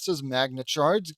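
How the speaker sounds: usual speaking voice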